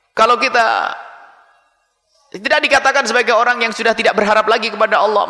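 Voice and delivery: usual speaking voice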